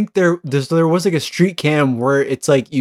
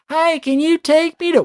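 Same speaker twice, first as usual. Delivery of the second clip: chirpy robot; 10 semitones higher than usual. Pretend. Robot asks a ride.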